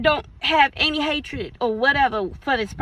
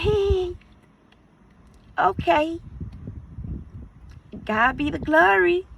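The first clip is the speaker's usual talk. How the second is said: fake polite cheer; 4 semitones higher than usual. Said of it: "your face could be like".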